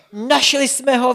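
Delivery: high, loud cry of discovery; shouted joy. A narrator's reading. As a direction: shout it triumphantly. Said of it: "vykřikli".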